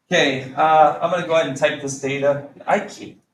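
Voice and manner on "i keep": ordinary delivery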